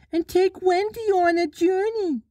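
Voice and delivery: put-on a high, boyish call; calling Nibs. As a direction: call up to the fairy as young Nibs. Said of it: "Nibs called".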